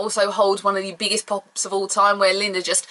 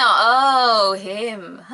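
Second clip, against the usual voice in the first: mock disappointment; 3 dB louder than usual. Disappointed crowd reaction.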